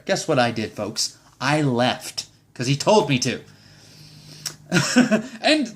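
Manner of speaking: normal talking voice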